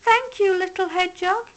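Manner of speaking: a moved doe thanking him; touched mother deer. A narrator's reading. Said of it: "said Mother Deer".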